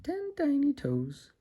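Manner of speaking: put-on high, slow sing-song for a baby; bright high baby-talk ending; singsong high. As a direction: lift it way up for the little one.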